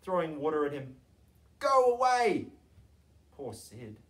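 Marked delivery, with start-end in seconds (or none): 1.6-2.5 high, loud angry yell; angry neighbours yelling at a howling dog; shouting. A narrator's reading. Woken street shouting at dog. 3.3-4.0 soft, pitying murmur; sorrowful. A narrator's reading. Narrator pitying sad dog.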